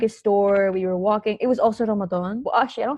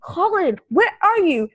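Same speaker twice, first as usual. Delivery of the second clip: high, loud mock-stressed demand; stressed caller; 9 semitones higher, 4 dB louder, slower than usual. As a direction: imitate a friend fretting down the phone. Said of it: "when he's in a bad mood or he's stressed".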